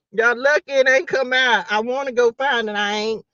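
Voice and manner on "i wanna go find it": ordinary delivery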